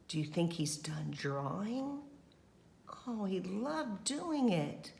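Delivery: plain reading voice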